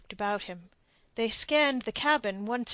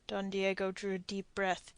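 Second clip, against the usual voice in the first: usual speaking voice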